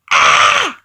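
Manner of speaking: sudden loud startled shriek; girl's scream. Retold or imitated. Little girl handed the wig, loud startled shriek. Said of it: "the little girl's like".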